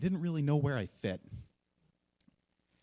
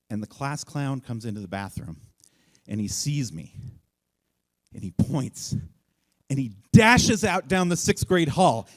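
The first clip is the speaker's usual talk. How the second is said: plain reading voice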